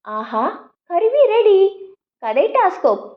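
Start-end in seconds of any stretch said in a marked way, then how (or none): 0.1-1.6 delighted exclaim. A narrator's reading. Poomakka finishes her device.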